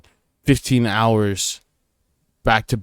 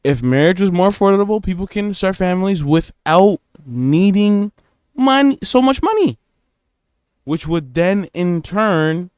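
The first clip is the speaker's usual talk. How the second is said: emphatic excited pitch; 6 semitones higher than usual. Real, not acted. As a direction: push the point high and hard. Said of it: "Let me cook".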